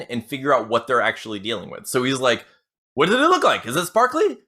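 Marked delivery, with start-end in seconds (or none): 2.9-4.4 high, loud eager questioning; acted excited grilling, breaking into laughter; incredulous dispatcher. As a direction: press the question, pitched up and half laughing. Dispatcher mockingly quizzes bitten caller.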